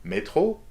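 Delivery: "métro" swooping up high at the end; rising question tune. Shown as a demonstration. Teacher in the street asking for the metro, high rising question. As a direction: ask with one word, sliding "métro" way up. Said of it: "If you are looking for the metro".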